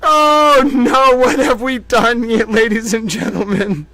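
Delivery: high, loud, laughing; laughing dismay. Real, not acted. Host laughing at his own mistake, loud dismay. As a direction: squeal in mock horror.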